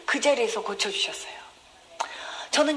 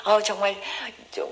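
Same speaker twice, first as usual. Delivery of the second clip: ordinary talk, nothing marked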